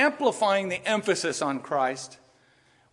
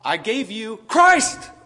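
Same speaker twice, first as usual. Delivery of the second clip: slow build to a loud, high CHRIST; pause, then burst out "Christ"; stress on CHRIST; 4 semitones higher, 7 dB louder, much slower than usual. Shown as a demonstration. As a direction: make CHRIST the booming point. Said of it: "I'm doing it on purpose".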